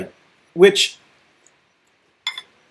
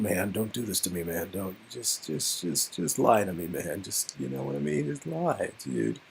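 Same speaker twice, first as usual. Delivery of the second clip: softer, halting, pleading whisper-edged voice; desperate Damien; 7 semitones lower, 11 dB quieter than usual. Acted. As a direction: beg the friend to lie to you.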